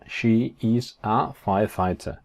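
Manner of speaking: slow, spaced-out words; careful word-by-word English; slow model sentence. Shown as a demonstration. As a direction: set each word apart for learners. Teacher models English sentence slowly.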